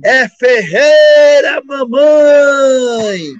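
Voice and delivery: slow desperate yell of his own name to mom; full shout. Retold or imitated. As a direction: stretch and yell every syllable. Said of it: "Eu falei assim".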